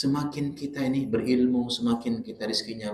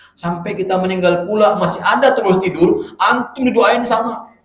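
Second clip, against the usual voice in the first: louder and higher, pushing the point hard; rising preacher shout; 7 semitones higher, 9 dB louder than usual. Real, not acted. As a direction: build it up like a fiery preacher. Preacher building to loud climax.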